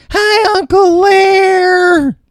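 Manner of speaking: put-on very high, shouted squeaky kid voice; Tommy's voice. Host voices little Tommy greeting Uncle Lear, squeaky and shrill. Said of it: "little Tommy".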